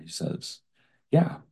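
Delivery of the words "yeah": put-on high chirpy little girl voice; sweet sing-song child. A narrator's reading. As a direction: chirp it brightly like a small child. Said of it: "Asia chirps in her sweet sing-song voice".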